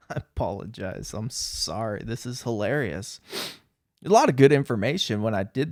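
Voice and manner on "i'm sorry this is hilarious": normal talking voice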